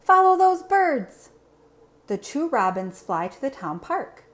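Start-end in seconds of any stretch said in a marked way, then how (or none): 0.0-1.3 high, loud call to follow the birds; cheerful teacher rallying the class; eager field-trip leader calling out; excited teacher. Acted. Teacher calls children to follow.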